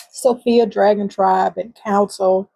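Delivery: usual speaking voice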